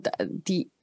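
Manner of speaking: ordinary talk, nothing marked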